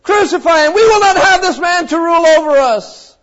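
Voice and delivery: high, loud mob cry; crowd shouting. Retold or imitated. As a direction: shout it like an angry crowd demanding death. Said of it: "cries perhaps even louder saying".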